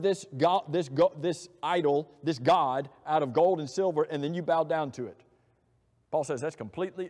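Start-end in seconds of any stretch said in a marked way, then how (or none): none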